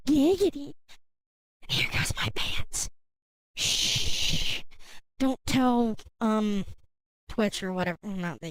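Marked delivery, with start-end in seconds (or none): 0.1-0.7 giggity voice. Retold or imitated. Catchphrase voice. 1.7-4.6 hushed secret whisper. Acted.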